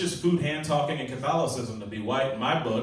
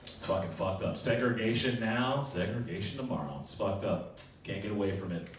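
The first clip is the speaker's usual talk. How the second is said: ordinary talk, nothing marked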